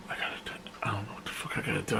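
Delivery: fast, breathless whisper to himself; inner thought whisper. Retold or imitated. Panicking to himself while freezing outside, fast whisper. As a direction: rattle it off under your breath. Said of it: "I'm thinking in my head".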